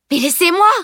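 high, indignant outcry; protesting. A narrator's reading. Girl grabbed by a stranger, high protest. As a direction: protest loudly at being grabbed. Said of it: "protesta-t-elle".

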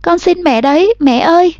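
high, desperate pleading; begging. A narrator's reading. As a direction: beg her mother, close to tears. Girl begging mother to come. (van xin mẹ tha thiết)

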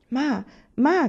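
high, loud excited call; crying out. A narrator's reading. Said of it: "gridò".

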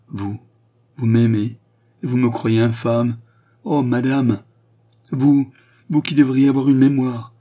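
bitter exclamation. A narrator's reading. Queen rebuking Charny who claims to love her, bitter. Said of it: "s'écria la reine avec un rire amer".

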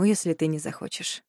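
ordinary delivery

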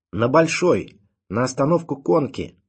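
put-on high, loud boyish call; ringing boy's shout. A narrator's reading. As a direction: shout it out like an eager errand boy. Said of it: "крикнул звонкий мальчишеский голосок".

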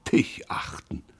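breathed scornful whisper; incredulous whisper. Acted. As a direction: hiss it in disbelief. Pierre repeats in disbelief.

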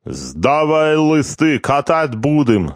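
put-on slow, high Georgian-accented invitation. A narrator's reading. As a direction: deal again in a Georgian accent. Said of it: "сказал Мзариули".

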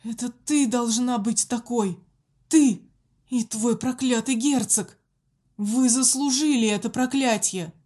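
loud, furious yelling; angry outburst. A narrator's reading. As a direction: scream the accusation. Prisoner bursts out at nun.

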